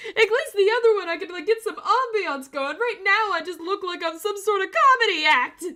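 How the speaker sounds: high, loud laughing complaint. Real, not acted. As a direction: crack up while protesting. Still laughing at herself.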